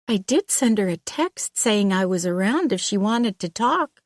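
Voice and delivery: an uneasy hairdresser, caught off guard; nervous young woman. Acted. Hairdresser explains.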